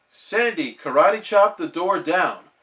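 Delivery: usual speaking voice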